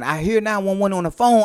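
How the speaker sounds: ordinary talk, nothing marked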